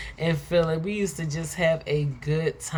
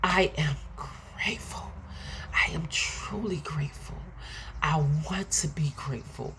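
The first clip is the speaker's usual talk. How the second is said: soft breathy whisper to yourself; whispered affirmation; 5 dB quieter than usual. Shown as a demonstration. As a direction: whisper it like a private promise. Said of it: "I want you to take a moment to say to yourself".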